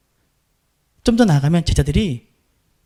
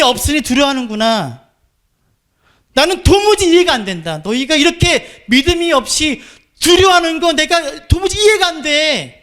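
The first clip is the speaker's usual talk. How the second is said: exasperated Jesus; 7 semitones higher than usual. Pretend. Pastor voicing Jesus to the fearful disciples, disbelieving. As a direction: climb in pitch as if you truly can't understand them, slowing on the last line. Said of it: "라고 주님 말씀하시는 거예요".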